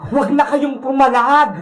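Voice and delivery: low villain voice with evil cackles; gloating wicked vendor. Acted. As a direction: gloat darkly and cackle at your captives. Villain taunts captives. (mababang boses ng masamang tindera, humahalakhak)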